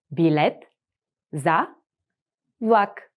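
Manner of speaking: each word said apart with pauses. Shown as a demonstration. Teacher spelling it out slowly for the class. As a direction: break it up word by word for the learner. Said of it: "split into words for repeating".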